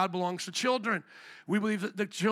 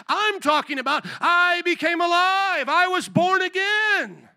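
much higher and louder, slow triumphant preaching; fervent proclamation; 9 semitones higher, 7 dB louder, slower than usual. Real, not acted. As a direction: declare it like the climax of the sermon. Preacher's climactic declaration.

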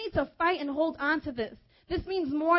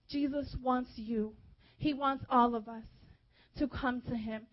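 ordinary talk

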